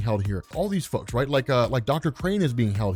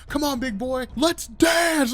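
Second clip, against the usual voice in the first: cocky battle taunt; 10 semitones higher, slower than usual. Retold or imitated. Jason Todd dares Batman before opening fire, shouting. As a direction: yell it like you're itching for a brawl.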